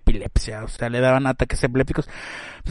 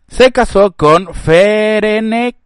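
dramatic; 7 semitones higher, 6 dB louder, much slower than usual. Acted. Dramatic reading of wedding.

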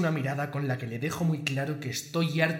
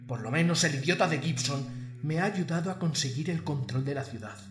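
normal talking voice

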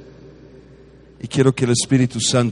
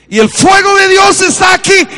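much higher and louder outburst; sudden preaching burst; 8 dB louder than usual. Acted. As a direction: break into fiery preaching. Preacher bursts out mid-story. (arrebato de predicador)

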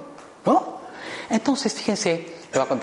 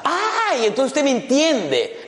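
relieved lifeguard; 12 semitones higher, much slower than usual. Retold or imitated.

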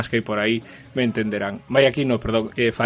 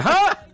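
sudden squeaky high "Ah!", much higher; mock high yelp. Podcaster mimics the woman ending the king's video, high yelp. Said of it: "Carapapel al final diciendo".

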